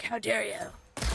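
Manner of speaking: slow drawn-out how dare you; lightly voiced snooty enemy; mock offended enemy. Far-off enemies voiced as mildly offended. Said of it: "they're like".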